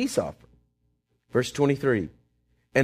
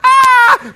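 short loud high "Ah!" shout; a sudden startled yell from the crowd; mock shout; 10 dB louder than usual. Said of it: "I don't know if they shouted like".

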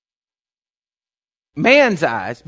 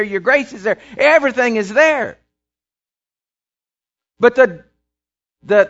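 raised, louder preaching voice driving each line home; preaching at full force; 6 semitones higher, 5 dB louder than usual. Acted. Preacher urging the congregation to run to God, preaching full force. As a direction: build and pound it like a revival preacher.

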